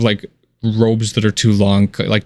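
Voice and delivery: normal talking voice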